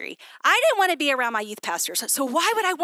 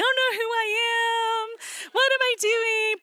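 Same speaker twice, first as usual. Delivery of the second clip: much higher, slow, hammed-up whimper; mock whiny lament; 11 semitones higher, slower than usual. Wife parodying her nightly identity crisis to her husband, high slow whine. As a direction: parody your younger self's helpless wail. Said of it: "every night he had to hear about a new identity crisis".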